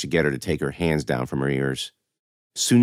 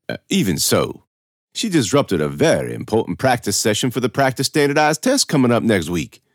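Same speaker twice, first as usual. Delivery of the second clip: higher, emphatic pompous official; officious administrator, objecting; 6 semitones higher than usual. Acted. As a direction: press the objection self-importantly. Narrator voices Mister Toll.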